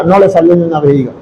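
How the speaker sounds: ordinary talk, nothing marked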